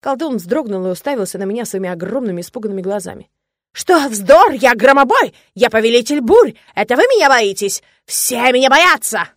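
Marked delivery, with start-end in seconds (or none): 3.7-9.3 squeaky blustering voice. A narrator's reading. The cowering sorcerer's squeaky bluster.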